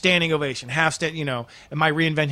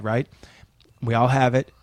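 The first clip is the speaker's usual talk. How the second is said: usual speaking voice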